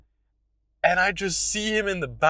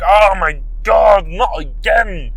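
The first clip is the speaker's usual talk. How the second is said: loud, slow, drawn-out complaint; exasperated groan; 6 semitones higher, 10 dB louder, slower than usual. Retold or imitated. Teacher exasperated at another failed slideshow, loud groan. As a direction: throw your hands up in despair. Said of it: "waving his hands in the air".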